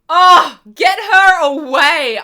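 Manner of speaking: loud, exasperated cry of disgust; frustrated outburst. Real, not acted. Fan ranting about a hated character, loud and angry. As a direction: shove her out of the story with your voice. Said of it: "I'm so mad".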